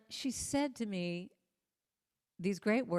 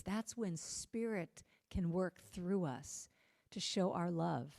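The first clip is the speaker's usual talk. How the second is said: ordinary talk, nothing marked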